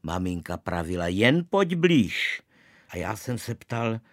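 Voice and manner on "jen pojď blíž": slow, much higher and louder command; stern summons. A narrator's reading. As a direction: drag out each word, losing patience. Mother luring boy for punishment. (maminka volá syna k trestu, pomalu a mnohem hlasitěji)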